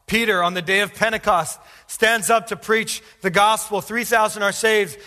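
high, loud, fervent sermon storytelling; full-force preaching. Real, not acted. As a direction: drive the story home from the pulpit at full force.

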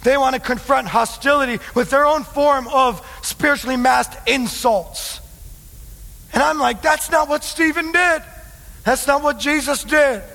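high, heated sermon voice; exasperated full-force preaching. Real, not acted. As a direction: preach it hard, rebuking the church.

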